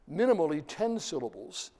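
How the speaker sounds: ordinary talk, nothing marked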